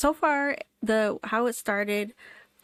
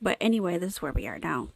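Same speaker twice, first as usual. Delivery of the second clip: usual speaking voice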